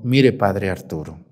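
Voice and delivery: low, slow imitation of a mother; permissive mother imitated. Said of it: "Me dijo".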